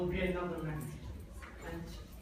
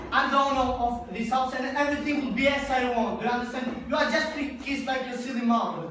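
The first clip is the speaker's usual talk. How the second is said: put-on loud and much higher, barking every line at the kids; furious tirade; 6 semitones higher, 8 dB louder than usual. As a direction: rage at the whole family, no let-up. Drunk father yelling at family.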